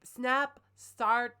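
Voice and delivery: ordinary delivery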